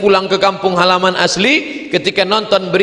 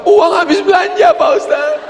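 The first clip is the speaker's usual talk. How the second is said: high whiny complaint; comic weeping lady; 3 dB louder than usual. Retold or imitated. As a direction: whine like the shopping lady. Lady explains tears, comic. (ibu-ibu mengeluh dengan suara tinggi)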